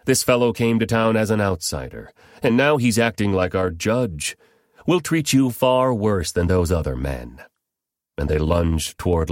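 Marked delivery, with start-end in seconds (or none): none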